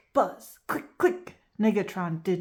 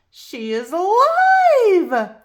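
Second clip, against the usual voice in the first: amazed; 11 semitones higher, 9 dB louder, slower than usual. Acted. Teacher sees talking gator.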